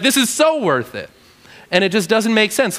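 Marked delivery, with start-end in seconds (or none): 0.0-1.1 higher, mock-satisfied line; sarcastic Jonah. Jonah insisting his anger is worth it, sarcastic. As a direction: sell it with sarcasm. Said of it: "he's just like".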